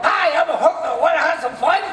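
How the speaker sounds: high, loud squawking parrot; shrill bird mimic saying a come-on. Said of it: "they scream out".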